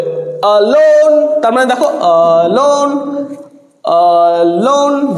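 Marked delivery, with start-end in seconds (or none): 0.0-1.4 slow, stretched alone with stress on লোন; stretched pronunciation demo; drawn-out demo word. Shown as a demonstration. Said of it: "pronounce করে দেখতে হবে". 1.8-5.1 alone drawn out slowly, low falling on লোন; slow teaching stress demo. Shown as a demonstration. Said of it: "যেখানে আমরা বেশি stress".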